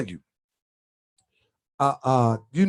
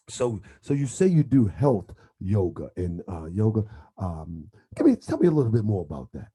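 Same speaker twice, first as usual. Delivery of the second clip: Grand Sheik radio voice; 9 semitones lower than usual. Retold or imitated. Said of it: "He be like".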